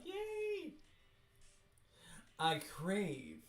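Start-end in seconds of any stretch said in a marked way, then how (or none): 0.0-0.7 very high, squeaky cheer; squeaky yay. Retold or imitated. Imitating the excited ballerina girl, squeaky cheer. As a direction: chirp it like a thrilled little girl. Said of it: "She's like".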